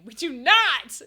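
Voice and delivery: shouted, alarmed veto; emphatic refusal. Real, not acted. Streamer rejects chat's game suggestion, emphatic shout. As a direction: shout down the idea.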